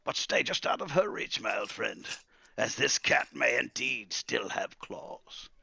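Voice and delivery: high start slowing to breathy caution; old wizard, sly warning. Acted. Wizard warning Calamus of the cat's claws, sly.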